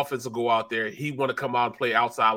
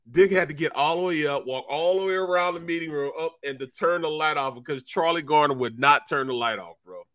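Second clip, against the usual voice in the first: usual speaking voice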